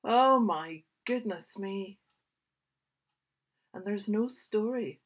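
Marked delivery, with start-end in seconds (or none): none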